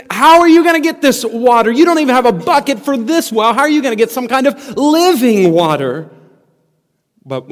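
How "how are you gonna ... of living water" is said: high, loud, exasperated questioning; pitched-up, hammed-up disbelief; incredulous challenge. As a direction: act out a baffled woman challenging him. Acted baffled woman challenging Jesus.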